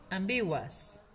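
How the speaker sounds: "Ambiguas" ending in u plus schwa and S. Shown as a demonstration. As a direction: repeat "Ambiguas" with a gliding uas ending. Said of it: "es ua".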